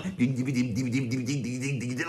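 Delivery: low, steady "ding" chug imitating a metal riff; galloping riff imitation. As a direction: patter out a galloping rhythm by mouth. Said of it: "that's all galloping along".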